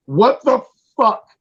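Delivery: ordinary delivery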